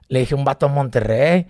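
ordinary talk

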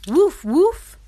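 high, loud playful woof; puppy barks. Acted. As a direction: yip like a little puppy. Mom voices the puppy.

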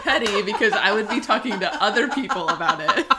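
loud, laughing confession; laughing admission. Real, not acted. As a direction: own up through real laughter.

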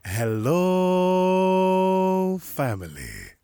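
drawn-out greeting. Real, not acted. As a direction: belt out a long hello, then drop the pitch. Drawn-out opening greeting to listeners.

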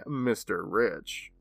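"Mister Rich" drawn out slowly with weight on Rich; mock fortune teller punchline, slow and weighty; slow emphatic reveal. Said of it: "Or should I say".

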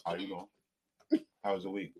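talking while chewing; words round a mouthful of food; mouth full. Real, not acted. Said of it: "eating and talking with your mouth full".

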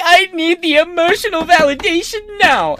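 very high, demanding caricature; mocking women. Man mocks women demanding validation, high exaggerated whine. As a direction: whine it in a mock female falsetto. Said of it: "women gotta be like".

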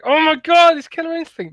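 high-pitched imitated fan squeal; mock excited scream. Watching E three with a friend on Skype, imitating excited screaming. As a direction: retell it as a giddy, high scream. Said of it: "we were, like, s-, screaming".

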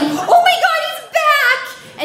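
very high, loud shriek; panicked scream. Retold or imitated. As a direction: shriek it to the operator in terror. Panic as truck returns.

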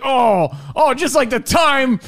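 high, loud mocking mom shout; teasing mom voice. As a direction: belt it out like a mom teasing a guest. Mom teasing relative at gathering.

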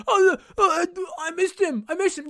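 high, whiny mock of a sorry defender; whiny mock excuse. Host mocking a defender chasing a receiver, in a high whiny voice. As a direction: whimper I missed him like a sheepish loser. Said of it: "And you're following the guy going".

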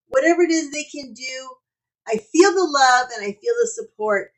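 loud host sign-off read; podcast outro. Acted. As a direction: close the show like an announcer.

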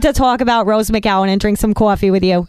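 broad put-on New York accent. Guest answering host in New York accent. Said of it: "Can you do the New York accent?".

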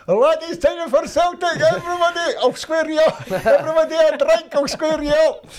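very high, loud comic bellow; retold ecstatic dad shouting rounds for everyone; boasting pub shout. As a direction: announce it to the whole boozer, hammed-up. Old man announcing to whole pub.

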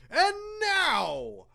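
grand stage introduction; showman announcer. Acted. Introducing the band's act.

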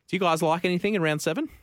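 normal talking voice

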